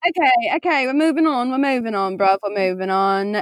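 put-on playful British accent. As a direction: move things along as a mock Brit. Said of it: "are we doing a British accent".

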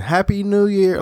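mock cheery greeting. Mimicking a New Year well-wisher, in mock cheer. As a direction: imitate someone going round greeting everyone. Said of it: "I don't go around saying".

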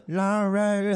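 high imitated animal cry; mock yell in a high voice; mock scream. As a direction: call it out high, like a screamed password. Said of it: "he screams like".

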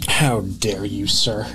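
theatrical, deep mock outrage; stagey period drama. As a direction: play an offended old gentleman.

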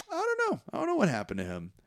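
high-pitched mock innocence; squeaky-high imitation of someone playing dumb; feigned ignorance. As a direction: play the bad liar, voice shooting way up. Acting Ernest feigning ignorance, badly.

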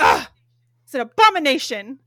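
abomination. punched out loud in mock outrage. Mocking an offended Karen, abomination. said loud. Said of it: "I love that word".

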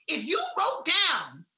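ordinary talk, nothing marked

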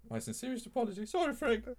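high, wobbly Michael Caine apology. Acted. Apologising to Frank Hornby, wobbly Michael Caine voice. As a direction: beg forgiveness as a trembling Michael Caine. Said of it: "your increasingly wobbly Michael Caine".

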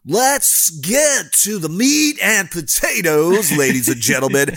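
booming high, slow announcer voice; fighting game announcer. Acted. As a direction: hype it up like a ring announcer. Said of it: "do the announcer voice".

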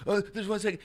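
put-on high-pitched kid narrating his own game; playing kid. Kid playing alone in the driveway, high make-believe voice. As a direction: pitch it up like a child at play. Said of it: "when you're a kid and you're playing".

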